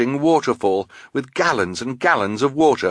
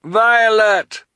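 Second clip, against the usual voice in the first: high, loud shout from afar; urgent high-pitched yell back to a friend; calling out; 12 semitones higher, 6 dB louder than usual. A narrator's reading. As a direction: call the name high and long. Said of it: "heard the triplets' voice".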